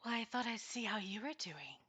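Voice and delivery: soft, otherworldly unicorn voice; a kindly unicorn speaking in the mind; gentle unicorn voice. Acted.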